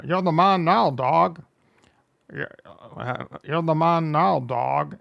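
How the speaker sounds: lightly voiced Connery, slurring the words; a hint of Sean Connery, hammed-up; Sean Connery impression. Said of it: "sounded like Sean Connery".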